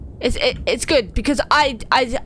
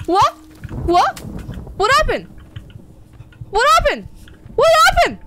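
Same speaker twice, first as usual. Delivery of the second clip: each what happened higher and louder; panicking, asking what happened, louder each time; rising panic; 12 semitones higher, 3 dB louder than usual. Real, not acted. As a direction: build from alarm to shrill panic. Panic after scare.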